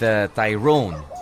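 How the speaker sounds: normal talking voice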